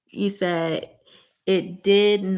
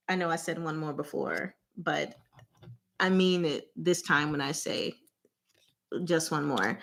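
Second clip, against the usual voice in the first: quiet aside; 4 semitones lower, 9 dB quieter than usual. Real, not acted.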